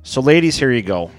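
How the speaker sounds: usual speaking voice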